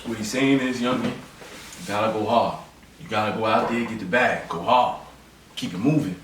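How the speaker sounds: very low, measured mentor voice; put-on deep voice of sage advice; wise elder. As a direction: counsel a young man in a deep, steady voice. Plays wise elder advising young man.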